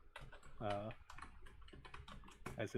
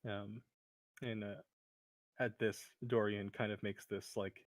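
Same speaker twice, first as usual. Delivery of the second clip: ordinary talk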